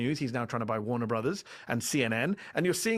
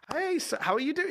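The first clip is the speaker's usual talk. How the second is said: very high, sing-song mock greeting; chirpy shop assistant; 14 semitones higher than usual. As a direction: overdo the cheery American shop greeting. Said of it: "be like".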